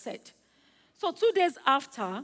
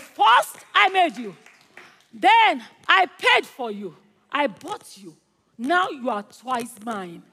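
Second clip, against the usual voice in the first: emphatic proclamation; 9 dB louder, slower than usual. Acted. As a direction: proclaim it step by step with force. Same words repeated for emphasis.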